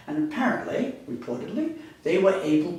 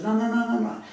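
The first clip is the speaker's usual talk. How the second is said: higher, firm repeated no; insistent refusal; 8 semitones higher, faster than usual. Retold or imitated. Mary insisting to the disciples, firm higher refusal. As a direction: refuse them, don't let it drop.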